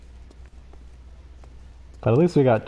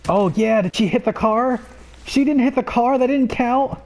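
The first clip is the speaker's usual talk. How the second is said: triumphant joy; 10 semitones higher than usual. Real, not acted. Celebrating after all three customers hit the truck, much higher elated cheer. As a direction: burst out in victory.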